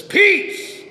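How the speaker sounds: high, loud, ringing command; commanding Peace. Retold or imitated. Jesus rebuking the wind, a loud ringing command. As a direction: call it out over the storm. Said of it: "stands up and says".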